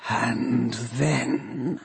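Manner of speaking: soft, slow, eerie question; drawn-out hushed inhuman voice; horrible voice. A narrator's reading. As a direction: ask it as an undead machine. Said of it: "asks the voice".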